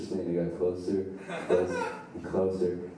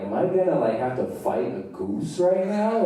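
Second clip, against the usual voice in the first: high-pitched alarm, easing down slightly; panicked disbelief; 6 semitones higher than usual. Acted. Teenager backed against hay bales by a goose, panicked disbelief. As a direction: question it in mounting alarm, pitched up.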